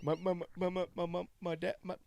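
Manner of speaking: mock stutter. Mocking a stutter.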